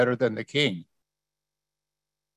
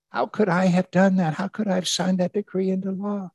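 higher-pitched self-reproach of a king; higher, distressed questioning; anguished king; 6 semitones higher than usual. Acted. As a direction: agonize aloud over your own mistake. Voices the sleepless king's anguish.

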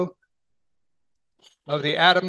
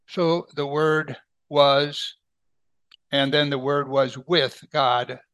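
slow solemn reading; slower than usual. Acted.

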